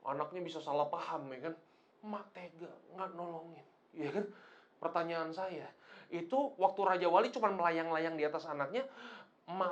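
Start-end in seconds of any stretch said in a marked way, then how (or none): 2.0-3.7 soft, wounded little complaint; quietly aggrieved; hurt reproach. Acted. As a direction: say it small and quiet, feeling let down. Voices hurt eaglet blaming mother.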